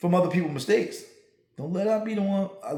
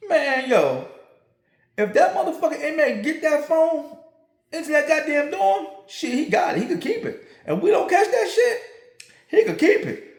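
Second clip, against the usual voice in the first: animated retelling; 8 semitones higher than usual. Retold or imitated. Retold officer explaining, animated.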